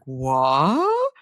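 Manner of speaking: long drawn-out "what", swooping sharply up; incredulous what. Retold or imitated. Two women baffled at being told to wait, incredulous. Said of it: "they were like".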